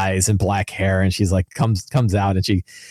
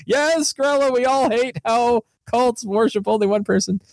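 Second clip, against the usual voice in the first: high, droning chorus of followers; mock cultists agreeing as one; obedient cult followers; 12 semitones higher than usual. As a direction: chant it high like brainwashed villagers. Cult followers parroting their leader.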